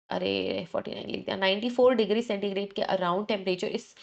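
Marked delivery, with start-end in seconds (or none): 0.1-1.4 whispered under the breath to herself; whispered correction of a written slip; muttered aside. Real, not acted. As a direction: mutter the slip quietly, off-mic.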